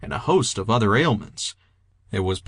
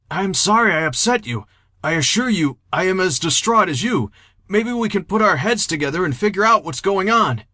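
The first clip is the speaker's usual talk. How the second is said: higher-pitched, polite pleading; distraught, placating Henry; 9 semitones higher than usual. A narrator's reading. Henry placating a manic Jeter, distraught and apologetic. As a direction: plead courteously with voice raised high.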